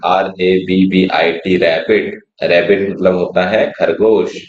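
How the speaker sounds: usual speaking voice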